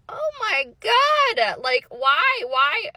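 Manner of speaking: loud, high, drawn-out mock despair; exasperated wail. Ex-wife asked to help yet another victim, exasperated wail. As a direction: wail it at the heavens, hammed-up. Acted reaction to request for help.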